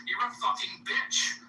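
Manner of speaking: normal talking voice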